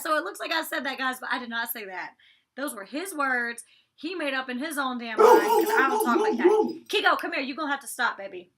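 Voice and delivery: normal talking voice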